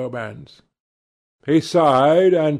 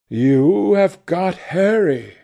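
slow, wistful sadness, higher in pitch; sad; 4 semitones higher than usual. A narrator's reading. Painter losing his friend to Harry, sadly. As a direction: draw it out with understated sorrow. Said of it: "said the painter sadly".

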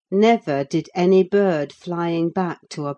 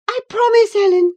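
put-on high, loud girlish plea; desperate cry; 8 dB louder than usual. A narrator's reading. Girl catching Ellen's dress, crying out. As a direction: cry it out urgently, high-pitched. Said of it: "she cried".

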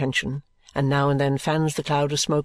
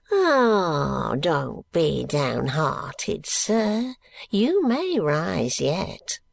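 put-on old Smallweed; much slower than usual. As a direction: stretch it out like a sly old miser. Smallweed voice, slow.

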